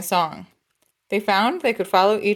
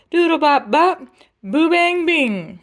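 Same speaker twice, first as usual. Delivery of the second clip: trumpet scat; 8 semitones higher, 4 dB louder, slower than usual. Shown as a demonstration. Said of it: "hear the trumpet go".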